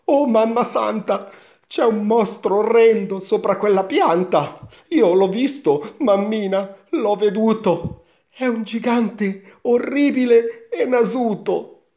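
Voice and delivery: put-on high, loud panicky boy voice; frightened boy. A narrator's reading. Boy tells mother about the monster, high fright. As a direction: gabble out the horror to mummy. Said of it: "per la gran paura".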